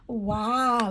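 usual speaking voice

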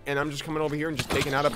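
normal talking voice

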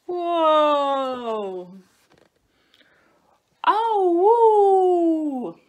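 long, high, loud howl; wolf howl. A narrator's reading. Two wolves fallen in the lake, howling. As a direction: wail like two soaked cubs. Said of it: "howled Little Wolf and Smells".

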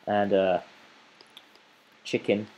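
normal talking voice

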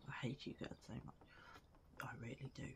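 low, soft grumbling aside; mock grudge. Player to friends who got the trophy, low mock-bitter grumble. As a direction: mutter a half-joking hatred under your breath.

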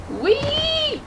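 long, very high squeal; drawn-out high falling-ride cry; playful whee. Pretend. Gamer dropping off a ledge, playful high whee.

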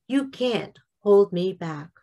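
ordinary talk, nothing marked

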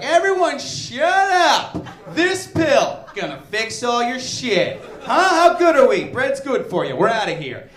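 loud, cocky, slow boast; mock cocky scientist announcing a discovery. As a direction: brag like a scientist who knows it all. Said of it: "They're always like".

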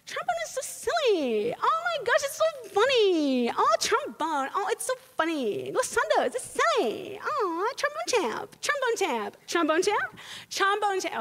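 high, goofy voice mocking trombone fans; giddy high mock voice laughing at the trombone; mocking silly fan. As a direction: squeal it like someone who thinks trombone is a joke. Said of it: "this one that you may all know".

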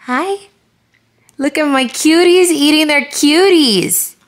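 high, slow cooing voice. Real, not acted. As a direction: coo over the babies, loud and high. Cooing at the babies.

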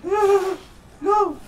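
high, loud screams of terror; terrified screaming. Real, not acted. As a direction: scream and beg for your life. Monster attacking, begs for life.

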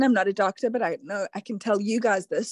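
ordinary talk

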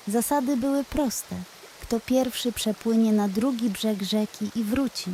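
ordinary delivery

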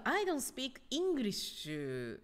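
"I don't speak English" slowly, with drawn-out ENGLISH; showcased English pronunciation. Shown as a demonstration. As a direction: show off "I don't speak English", high-starting and stretched. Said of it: "の発音、". (「I don't speak English」をゆっくり得意げに発音)

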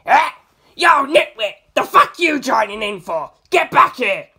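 high loud angry yell. A narrator's reading. Nijimura yelling at his stand to come back, loud and high. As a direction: shout him back like a furious owner. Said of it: "Nijimura Fukushige yelled".

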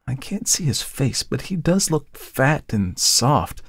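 put-on a touch of a worried young woman; Tessie, young model. A narrator's reading.

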